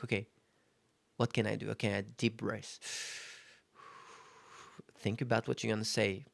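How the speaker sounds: murmured reminder to breathe; quiet self-talk. Shown as a demonstration. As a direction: talk yourself down gently.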